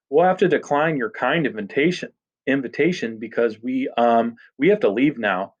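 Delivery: plain reading voice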